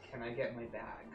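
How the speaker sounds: ordinary delivery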